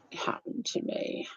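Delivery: slow breathy whispered aside. Real, not acted. Thinking aloud to her viewers, whispered. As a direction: murmur it to yourself, slowly. Musing to herself mid-vlog.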